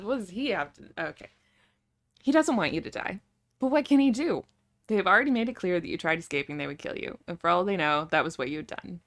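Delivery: ordinary talk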